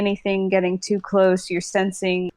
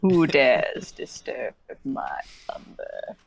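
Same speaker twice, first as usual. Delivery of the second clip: villain voice; 4 semitones lower, slower than usual. Acted. Mock villain line.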